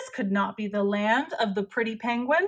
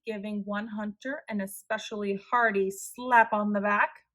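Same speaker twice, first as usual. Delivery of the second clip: plain reading voice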